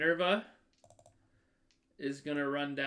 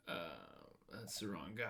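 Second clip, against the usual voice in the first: usual speaking voice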